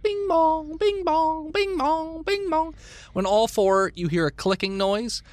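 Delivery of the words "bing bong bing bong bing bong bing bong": high sung bing bong chime; high chime sound. Acted. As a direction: sing it like an electronic door chime. Scanner chime done by GM.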